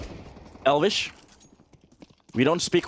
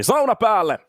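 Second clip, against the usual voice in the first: excited call; 8 semitones higher, 7 dB louder than usual. Real, not acted. Decides to turn sauna on.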